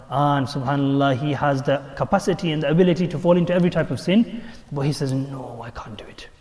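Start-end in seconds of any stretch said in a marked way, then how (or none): 5.1-6.3 very soft, low self-restraint; quiet refusal. Acted. Young man turns away from sin, hushed refusal. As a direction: say it under your breath.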